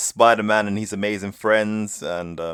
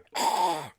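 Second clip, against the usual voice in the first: dramatic gasp; 9 semitones higher, 4 dB quieter than usual. Retold or imitated. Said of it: "jump the table and go".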